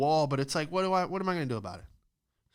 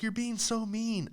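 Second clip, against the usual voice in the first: higher, slower mock whine; whiny girl; 9 semitones higher than usual. Mocking the girl from the argument, a higher whiny voice. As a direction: pout it out like she's hurt. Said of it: "She was like".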